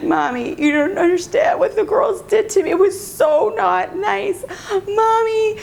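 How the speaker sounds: high, whiny mock wail to mommy; upset daughter, imitated. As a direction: wail a hurt child's complaint, drawing it out. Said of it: "had, like, the worst day".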